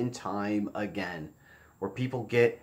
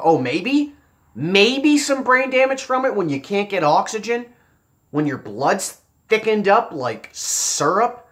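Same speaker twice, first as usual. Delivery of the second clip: much higher, louder, scornful disbelief; incredulous; 8 semitones higher, 8 dB louder, slower than usual. Real, not acted. Vlogger scoffing at talk of mere brain damage, loud incredulous. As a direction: throw the question back, outraged.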